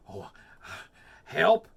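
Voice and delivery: loud startled cry; frightened yelp. Acted. Fox seeing the Gruffalo, loud frightened yelp.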